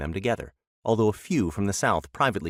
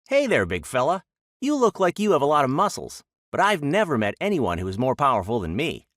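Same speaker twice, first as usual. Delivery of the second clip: loud, high, cheeky taunting; cocky taunt; 6 semitones higher, 4 dB louder than usual. Acted. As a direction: goad a big bison from below. Rabbit taunting the bison.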